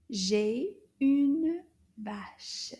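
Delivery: drawn-out, whispered model sentence; very slow repeat. Shown as a demonstration. Teacher repeats sentence slowly for children, whispered. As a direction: stretch every word for learners.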